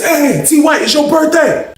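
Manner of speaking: high birthday shout-out; excited calling out. Retold or imitated. Inmate down there greeting him on his birthday, excited call. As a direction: call the news down the row, pitched high.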